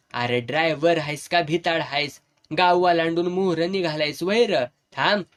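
plain reading voice

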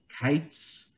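ordinary talk, nothing marked

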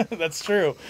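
ordinary talk, nothing marked

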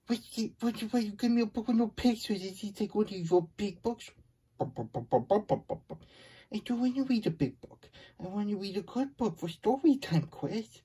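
high, whiny complaint at the book choice; mock outrage. Reader complaining to Chris about a book without pictures, high and indignant. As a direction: pout it out, protesting to Chris.

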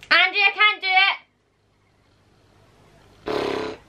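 high, pleading wail; real frustration, pitched right up; exasperated whine. Real, not acted. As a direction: wail to a partner in defeat. Gives up, calls to her partner.

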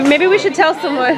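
ordinary delivery

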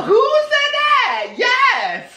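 very high, drawn-out squeal of disbelief; mock startled shriek. Repeats the mock startled reply.

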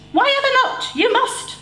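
high mock shriek from mum. A narrator's reading. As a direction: mum shrieking at her scared son, high. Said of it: "Mum shrieked".

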